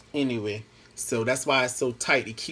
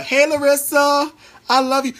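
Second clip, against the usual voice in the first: higher, louder happy call to a viewer; excited shout-out; 13 semitones higher, 8 dB louder than usual. Real, not acted. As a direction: call out to Larissa with delight. Calling out to a viewer.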